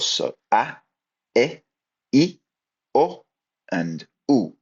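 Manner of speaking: a, e, i, o, u each said clearly and separately with a pause between; vowels pronounced clearly. Shown as a demonstration. Teacher to students, sounding out each Spanish vowel clearly. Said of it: "Really pronounce those".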